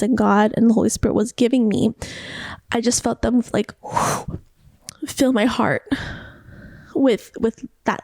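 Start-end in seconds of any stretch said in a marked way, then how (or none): 3.8-4.4 soft exhaled whoosh; imitated whoosh. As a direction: blow out a rush of air. Imitates love filling her heart.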